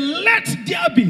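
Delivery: high, ringing preacher's shout; scream. Retold or imitated. Preacher voicing God calling out the creation. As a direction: shout the command into being. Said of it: "And God screamed, he screamed".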